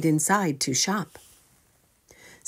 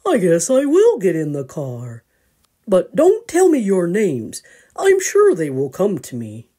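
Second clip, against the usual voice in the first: loud father bear; 6 dB louder than usual. Acted. Mister gets in.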